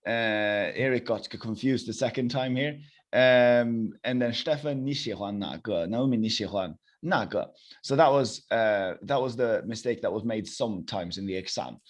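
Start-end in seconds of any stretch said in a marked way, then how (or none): none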